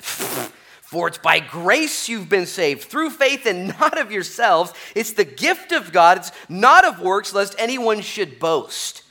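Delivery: slow, loud, high triumphant declaration; laughing Paul proclaiming. Acted. Paul laughing in his cell, declaring grace loudly. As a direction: announce it from the cell, slow and ringing. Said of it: "he says, verse eight".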